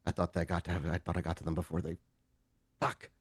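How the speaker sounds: low, hushed mumble under the breath; very soft, low, muttered regret; mumbling. Acted. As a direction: mumble it low, almost to yourself. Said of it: "you hear him mumble".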